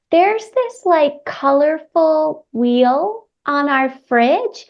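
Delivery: put-on slow, high voice of a four-year-old; little boy. Four-year-old telling children's church leader about the wheel, little boy. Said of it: "came up to me and he was like".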